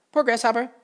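loud, high, boastful dragonflies; brash dragonfly voice. Acted. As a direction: call out high and loud, showing off.

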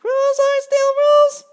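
very high, loud slow mosquito shout. A narrator's reading. Mosquito shouting its rule at Grasshopper, very high and loud. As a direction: yell the rule in a tiny piercing voice. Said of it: "shouted the mosquito".

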